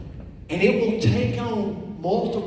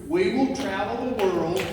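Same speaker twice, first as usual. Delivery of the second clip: ordinary delivery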